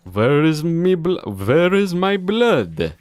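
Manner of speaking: vampire voice. Acted. Dracula wanting blood, slow loud mock vampire. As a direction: demand the blood like a cartoon vampire.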